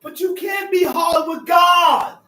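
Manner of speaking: full-force pulpit preaching; fiery preaching. Acted. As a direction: preach it hard to the room.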